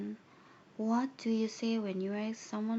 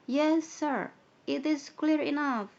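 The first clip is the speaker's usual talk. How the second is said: higher, louder eager pupil voice; pupils' loud reply; 6 semitones higher, 8 dB louder, slower than usual. Acted. Pupils answer the teacher, high and loud.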